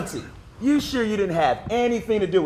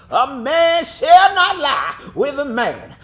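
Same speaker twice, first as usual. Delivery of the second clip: mock preacher; 4 semitones higher, 7 dB louder than usual. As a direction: ham up the pastor's pulpit voice. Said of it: "had the nerve to quote Leviticus".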